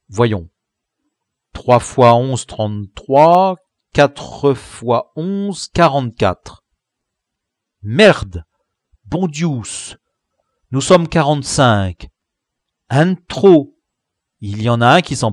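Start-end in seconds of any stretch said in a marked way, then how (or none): 1.5-6.6 slow working out sums. Acted. Boy working out sums aloud. 7.8-13.7 dismayed outburst. Acted. Dismayed at one too many.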